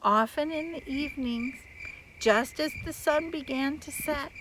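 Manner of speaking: gentle farewell. A narrator's reading. Storyteller softly narrating the Firebird's farewell. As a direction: ease into a calm ending. Wistful soft ending.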